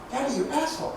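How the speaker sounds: soft imitation of a toddler; small, quiet child barely managing words; Lindsay. Two-year-old to dad at dinner, soft imitated baby talk. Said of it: "Lindsay's just barely able to talk".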